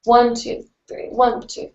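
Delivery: steady counted beat, one two three; counting the beat. Shown as a demonstration. Counting along for viewers learning breaks and paddles, steady. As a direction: count it out evenly for the hooping move. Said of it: "So counting helps".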